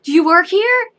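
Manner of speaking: put-on high, loud curious child; little girl voice. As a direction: ask like a bold toddler. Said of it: "she goes".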